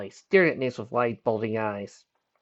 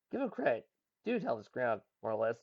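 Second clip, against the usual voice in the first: ordinary delivery, nothing marked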